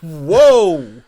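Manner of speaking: loud, drawn-out "whoa"; big playful shock. Real, not acted. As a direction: let out a big stunned whoa. Reacting in shock to the answer.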